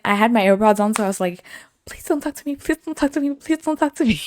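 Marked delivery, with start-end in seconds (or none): 1.9-4.2 high pleading. Retold or imitated. Pleading silently with the stranger.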